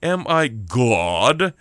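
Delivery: very slow, heavy-stressed condescending king; pompous, drawn-out disdain. Acted. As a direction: draw GOD right out, talking down. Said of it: "when they're all condescending".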